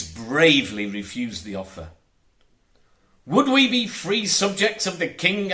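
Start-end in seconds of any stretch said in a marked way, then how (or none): 3.2-5.5 put-on high, eager boy asking; a young lad speaking up; John questioning the pirate captain; boy's voice. A narrator's reading. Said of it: "asked John".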